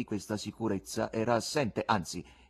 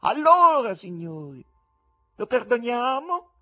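high, loud showman's question, rising at the end; 10 dB louder than usual. A narrator's reading. Showman asking the spectators for a verdict, loud high question. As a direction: put it to the crowd, ringing out.

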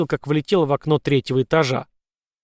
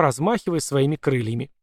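usual speaking voice

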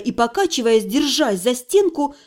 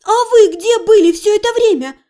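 accusing; 8 semitones higher, 5 dB louder than usual. A narrator's reading. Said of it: "решила я обвинить".

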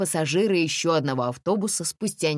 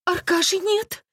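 high, drawn-out cry of alarm; distressed young mother's cry; alarmed exclamation; 4 dB louder than usual. A narrator's reading. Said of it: "воскликнула Катя".

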